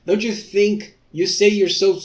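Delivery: mocking rant. Real, not acted. Ranting at character.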